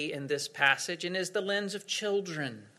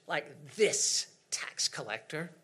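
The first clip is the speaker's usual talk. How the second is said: scornful whisper; 4 semitones lower, 5 dB quieter than usual. Retold or imitated. Whispered aside about tax collector.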